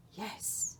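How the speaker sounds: a soft, unvoiced whisper; the Dark's whisper. The Dark calls to Laszlo in a whisper. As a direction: breathe his name from the shadows.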